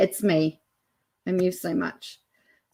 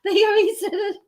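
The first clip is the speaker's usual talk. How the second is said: high-pitched words through long laughter; real uncontrollable laughing. Real, not acted. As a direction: laugh so hard you can barely talk. Cracking up at the courtroom clip.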